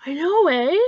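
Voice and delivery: loud, lifted Canadian agreement with a tag "eh"; hearty Canadian "I know, eh?". Acted. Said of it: "someone says something agreeable and you're like".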